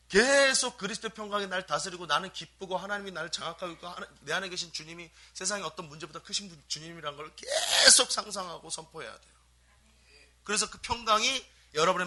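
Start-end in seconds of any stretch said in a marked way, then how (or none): none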